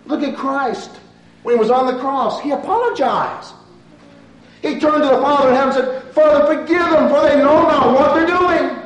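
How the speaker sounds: loud, high, fervent preaching; preaching at full force. Acted. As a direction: drive the plea home to the congregation.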